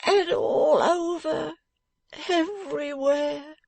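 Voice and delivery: high, slow, tearful wail; moaning. A narrator's reading. Beaten man telling where it hurts, high moaning. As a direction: stretch each word into a moan. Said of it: "moaned Fledgeby, rocking himself".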